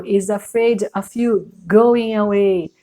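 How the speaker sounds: usual speaking voice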